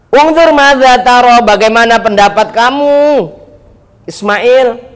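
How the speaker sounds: a father gravely asking his son, slowly; Abraham asking his son. Acted. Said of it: "kalau Nabi Ibrahim ketemu Nabi Ismail". (Ibrahim bertanya pelan dan lantang kepada Ismail)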